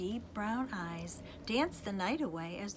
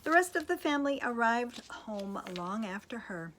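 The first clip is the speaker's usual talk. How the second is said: ordinary delivery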